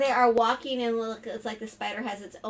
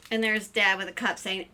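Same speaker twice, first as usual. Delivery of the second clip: plain reading voice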